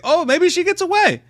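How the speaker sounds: high, excited false hope; naive hopefulness. Retold or imitated. Viewer thinking she might escape, naively hopeful. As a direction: pitch it way up, wishfully.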